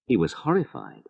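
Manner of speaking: plain reading voice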